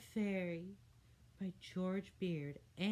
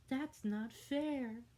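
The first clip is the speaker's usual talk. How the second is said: whiny complaint; 7 semitones higher, much slower than usual. A narrator's reading.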